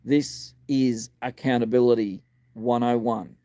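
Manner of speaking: normal talking voice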